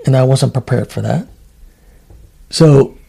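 ordinary talk, nothing marked

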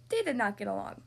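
plain reading voice

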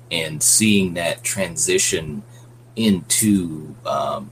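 ordinary delivery

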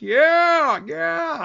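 put-on high, drawn-out drunken cheer; imitating a drunk heckler cheering; cheering drunk guy. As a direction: whoop like a drunk egging on a fight. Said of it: "There's a drunk guy laughing and cheering on".

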